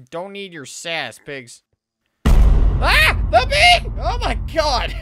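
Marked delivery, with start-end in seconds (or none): 2.2-4.9 much higher, louder panicked shriek; startled scream. Real, not acted. Player shrieking as a bee startles him in the game, real fright. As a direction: yelp as the bee jumps you.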